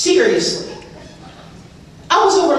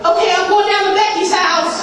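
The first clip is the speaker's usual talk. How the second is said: put-on much higher, breezy teen voice; chirpy kid; 8 semitones higher than usual. Daughter telling her mother she's going out, much higher. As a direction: toss it off brightly like a sly teen. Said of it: "And I'm like".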